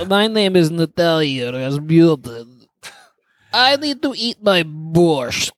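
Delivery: slow, hammed-up Russian accent; mock Russian lady. Introducing himself as a Russian lady, in a slow mock accent. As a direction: drag the words in a jokey Russian lady voice. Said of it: "ladies with Russian accents".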